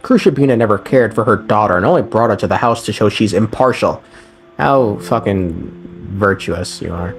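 ordinary talk

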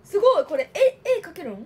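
amazed she can draw on it; surprised delight; excited surprise. Real, not acted. Discovering the panel is drawable.

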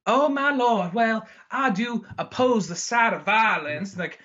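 high, prissy Southern drawl, then a sudden curse; dainty Southern gentleman. Retold or imitated. To his co-host, mocking the dainty Southern character in a high Southern voice. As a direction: play a dainty Southern gent losing it. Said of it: "very dainty Southern kind of guys".

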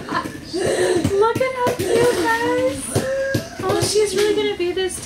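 high teary coo. Real, not acted. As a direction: melt over how cute it is. Gushing over baby clothes.

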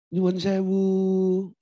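slow, drawn-out polite plea; polite Javanese person. Acted. Javanese to the person on their foot, overly polite. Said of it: "kalau orang Jawa beda".

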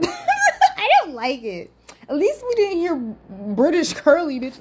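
high, drawn-out whine through a laugh; slow, high laughing protest; laughing complaint. Real, not acted. Laughing complaint about Jimmy's voice.

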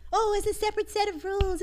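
high-pitched mocking imitation; mocking objection. Host mimicking an objector to double standards, mockingly high. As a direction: parrot a complainer in a high voice. Mocks an objection, then rebuts it.

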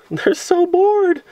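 high drawn-out mock of the bored staff; high, slow sarcastic jab at the bored inspectors; mocking sing-song. Real, not acted.

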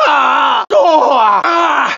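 sharp high yelps of pain; pain yells. Acted. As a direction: cry out as the blows land. Hunter gets hit.

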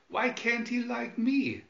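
plain reading voice

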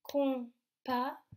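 "compas" split, with a separate second syllable "pas". Shown as a demonstration. Teacher showing where P is heard, split syllables. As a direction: hold com, pause, then release pas. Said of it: "on entend le P dans la deuxième syllabe".

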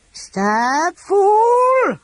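high, loud dragon hiss; hissing serpent dragon. A narrator's reading. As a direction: spit it like a furious serpent. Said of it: "the dragon hissed".

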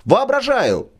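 Воображаю thrown up high and bitter. Acted. Брыкович repeats it to the drunk, mounting scorn.

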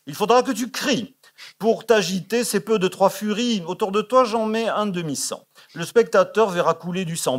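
hammed-up glee at the bloodshed; theatrical excitement. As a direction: push it up like a composer gloating over horror. Gluck demanding cries and blood.

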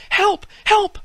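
shrill high panicked cry; young ladies panicking as the tree catches fire, screaming for help. A narrator's reading. Young ladies see the tree on fire, shrieking for help. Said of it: "cried the young ladies".

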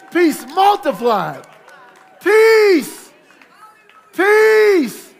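loud, high, building praise cries; ecstatic praise. Acted. Preacher leading the church in praise, building to shouts. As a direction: lift the room, climbing to a peak.

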